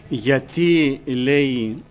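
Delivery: normal talking voice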